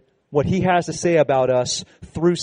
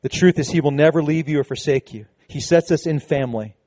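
usual speaking voice

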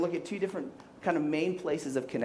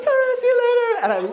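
much higher and louder, breezy goodbye; breezy young woman; 8 dB louder than usual. Retold or imitated. Young woman casually leaving after the double kiss, much higher. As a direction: toss off the goodbye like nothing happened. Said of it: "she comes like".

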